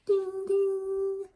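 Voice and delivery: high, loud, ringing dings; bicycle bell imitation. A narrator's reading. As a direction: sing out like a bike bell. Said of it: "Duck rang his bell".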